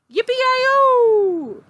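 high, loud yippee-yi-yo shout; cowboy whoop. A narrator's reading. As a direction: whoop with delight. Cowboy whoops outside again.

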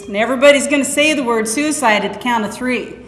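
usual speaking voice

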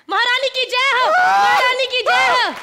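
loud, high chant of "महारानी की जय हो"; hammed-up royal hail. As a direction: cheer for the queen like a herald. Daughter hails the queen. (महारानी की ज़ोरदार जयकार)

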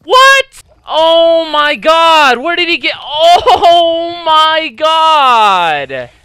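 screaming in disbelief; furious shout. Real, not acted. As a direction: yell it, totally outraged. Shocked rage at game death.

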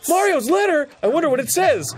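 high, loud fake wonder; mock excitement. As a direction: gush like a cheery kid. Mock-reading game enthusiasm.

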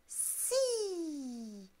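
hissed like a snake, rising high. Acted. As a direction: stretch each si into a snaky hiss. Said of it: "il siffle comme le serpent".

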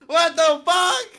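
high, loud, drawn-out question; stunned disbelief. Real, not acted. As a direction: stretch it out in shock. Shock at blindfold reveal.

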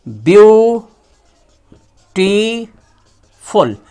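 Beautiful drawn out very slowly, each syllable apart. Shown as a demonstration. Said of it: "इसका तीन खंड होगा".

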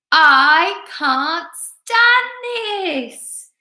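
very slow, high, loud wail; drawn-out refrain for everyone to join; exasperated bear. A narrator's reading.

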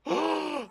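high, startled gasp; real gasp of alarm; sharp gasp. Real, not acted. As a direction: suck in breath in shock. Shocked by the film.

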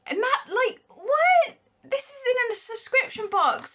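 high-pitched, incredulous exclaiming; astonished disbelief. Real, not acted. Vlogger amazed this came in a subscription box, incredulous. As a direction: squeal it, unable to believe it. Overwhelmed by the gift.

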